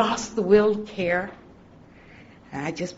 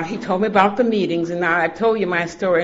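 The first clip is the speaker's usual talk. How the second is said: normal talking voice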